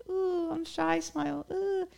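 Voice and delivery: high, soft, coy little coo; shy smiler. Acted. Acting out someone giving a little soft smile, shy. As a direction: put on a tiny, bashful high voice. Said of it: "like, a little soft smile".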